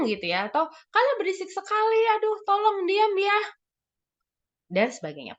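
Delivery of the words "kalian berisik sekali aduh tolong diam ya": mock yelling. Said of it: "teriak-teriak".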